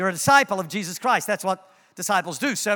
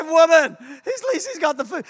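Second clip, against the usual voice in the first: laughing protest; 8 semitones higher, 5 dB louder than usual. Retold or imitated. Laughing retort to dog trainer.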